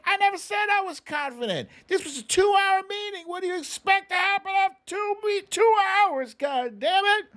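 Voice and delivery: high, loud, fast mock rant ending in a shout; mock angry Biden. Vlogger imitates Biden yelling at a reporter, hammed-up shout. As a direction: play an exasperated president yelling at a reporter. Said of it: "And he's like".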